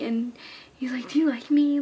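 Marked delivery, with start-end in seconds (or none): 1.1-1.8 louder, hopeful retold question; happy question. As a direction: imitate him asking it happily. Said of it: "Like, in a happy way".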